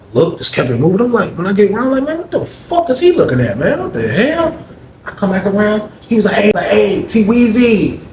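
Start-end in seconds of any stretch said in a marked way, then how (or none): 2.0-4.6 baffled outburst. Retold or imitated. Inner reaction to being stared at. 6.3-8.0 much higher, stretched-out sweet call of a name; a cellmate sweetly calling T Weezy over; Greg's sing-song greeting. Retold or imitated. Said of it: "That's how he said my name now".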